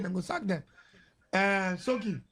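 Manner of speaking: ordinary talk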